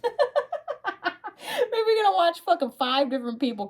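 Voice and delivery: words squeezed out through high laughter; giggling while reading the cards; laughing. Real, not acted. To her viewer, laughing at the surprising cards, high and slow.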